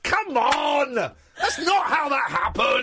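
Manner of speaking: high indignant outcry; mock shout at screen. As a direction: yell at the screen in disbelief. Shouting at the film.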